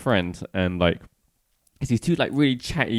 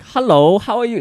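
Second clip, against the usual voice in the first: high-pitched put-on Chinese accent; mock Chinese accent; 11 semitones higher, 4 dB louder than usual. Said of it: "You should sound like that".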